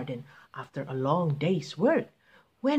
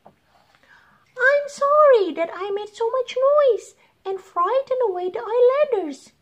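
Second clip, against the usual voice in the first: sobbing little bird; 5 dB louder than usual. Acted. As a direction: apologize as a small weepy bird. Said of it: "he sobbed".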